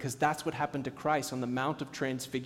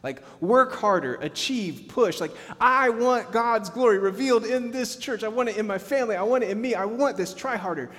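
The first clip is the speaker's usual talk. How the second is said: higher, louder, pressing on and on; driven striving; 7 semitones higher, 6 dB louder than usual. Retold or imitated. Pastor voicing his own striving to the church, urgent and driven. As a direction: press like someone who can't stop pushing. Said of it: "You can hear it in my voice how much I'm like".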